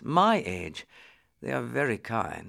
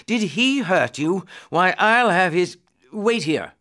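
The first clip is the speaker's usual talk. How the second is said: high, louder outburst of outrage; indignant prince; 6 semitones higher, 5 dB louder than usual. Acted. Prince discovers the guard hurt the boy, indignant. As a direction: flare up, then order abruptly.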